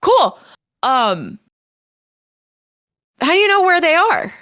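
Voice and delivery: high, loud quick questions; chirpy in-character asking; eager character voice. Acted. Player speaks in character.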